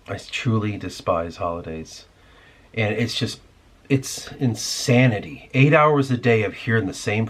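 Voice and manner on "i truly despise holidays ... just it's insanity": ordinary talk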